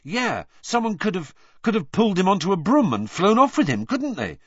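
put-on high, hurried boy voice; quick eager. A narrator's reading. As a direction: rush it out hopefully. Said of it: "said Ron quickly".

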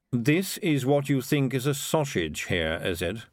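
slow, drawn-out query; disdainful piper. Acted. Piper sneers at town's sausage, slow and disdainful. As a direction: take your time over each word.

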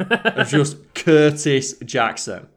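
savouring the name. Pretend. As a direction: roll the name out like it's powerful.

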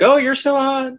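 very high, put-on gushing girl voice; mock swooning fan girl. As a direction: squeal it like a smitten fan, way up high. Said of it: "And they're like".

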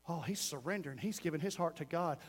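soft, low acted murmur; hushed aside. Onlooker watching someone raise hands at altar, hushed aside. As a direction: play an onlooker's hushed remark.

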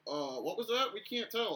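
acted confused reply. Box workers who can't tell the call, acted confusion. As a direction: call out as if you couldn't hear the ref. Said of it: "and we're like".

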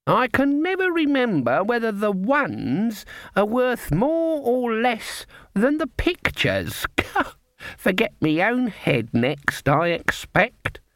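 put-on high, slow old-woman voice; doddery old Granny. A narrator's reading. Granny feigning confusion over cards, high and doddery. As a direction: play the muddled innocent, pitched right up.

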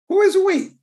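high, loud incredulous cry; shouted mock outrage; incredulous question. Real, not acted. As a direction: challenge the word we in disbelief. Preacher mocks Moses's claim.

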